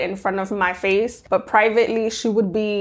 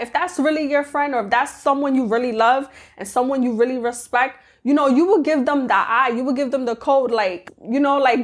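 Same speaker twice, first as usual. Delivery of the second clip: ordinary delivery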